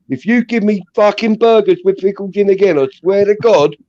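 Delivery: high-pitched retold threat; angry threat. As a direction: threaten the burger man, high and heated. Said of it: "I was so angry".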